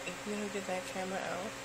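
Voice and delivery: voicing the dog. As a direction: give the dog a low grumbling voice. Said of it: "He's like".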